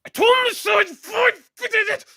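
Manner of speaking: very high, drawn-out shriek; loud caricature of a sister losing it; mock enraged sister. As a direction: screech it in hammed-up fury. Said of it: "and now she's like".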